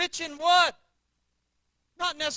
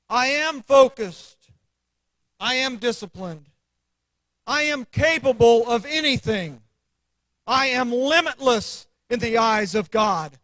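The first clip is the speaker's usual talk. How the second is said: with conviction; 6 dB louder than usual. Shown as a demonstration. Preacher to congregation, proclaiming affirmations loudly. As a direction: plant every I am like a flag. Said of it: "you have to say it with conviction to yourself".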